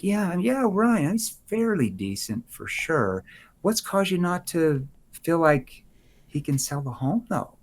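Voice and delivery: hesitant, held-back tone. Shown as a demonstration. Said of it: "See, my tone shifts them".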